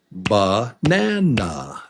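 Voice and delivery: Banana slow, in three drawn-out beats; syllable by syllable. Shown as a demonstration. Teacher back to counting beats, Banana slow and split. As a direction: stretch Banana into separate syllables. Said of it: "So there it is again".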